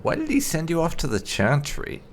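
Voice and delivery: ordinary talk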